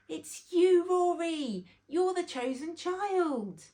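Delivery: loud, high triumphant call; joyful announcement. Acted. Grandad telling Rory he is the chosen child, loud joyful announcement. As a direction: proudly reveal the big news.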